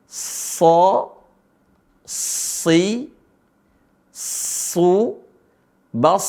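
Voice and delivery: "So, si, su, bas" with long heavy hissed s; sad drill. Shown as a demonstration. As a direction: model "So, si, su, bas" one by one, sibilant flowing. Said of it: "Perhatikan sekali lagi, ikutin".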